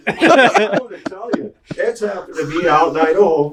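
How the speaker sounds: high laughing imitation; laughing mock dad.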